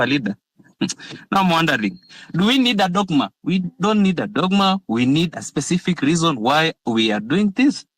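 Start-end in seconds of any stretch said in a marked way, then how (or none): none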